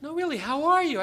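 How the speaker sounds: high, loud pressing question; insistent probing. Retold or imitated. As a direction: press for the real answer.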